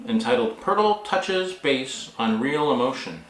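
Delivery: ordinary delivery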